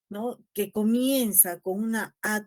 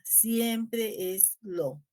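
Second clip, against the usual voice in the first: very slow emphasis; much slower than usual. Shown as a demonstration. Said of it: "teacher repeats a key rule".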